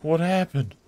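high, loud disbelief; shocked what-happened outburst; surprised. Real, not acted. Finds wrecked penguin statue.

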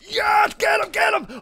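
high frantic urging; thrilled high shouts; excited cheering. Real, not acted. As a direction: egg them on way up high. Cheers on attack in game.

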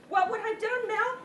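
high-pitched worried question; anxious alarm. Acted. Victim imagining walking in on burglars, alarmed.

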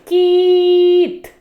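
«Кіт» high and loud, «і» stretched; stressed i. Shown as a demonstration. Teacher highlighting the vowel for children, stressed. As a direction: pop the «і» in «Кіт». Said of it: "виділяю голосом".

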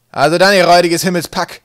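very loud, high rousing roar; bellow. A narrator's reading. Captain rousing his sky pirates to sail, bellowing. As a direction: bark at the whole crew. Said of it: "brüllte Wolkenwolf".

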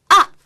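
아! falling sharply like a sudden prick; short pained 아! dropping; sharp ouch. Shown as a demonstration. Host shows fourth tone by a thorn prick. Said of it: "가시에 이렇게 딱 찔렸을 때".

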